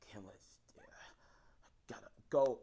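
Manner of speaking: urgent whisper, slow at the end. Retold or imitated. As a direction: breathe it under your breath. Deku sneaking away, hurt.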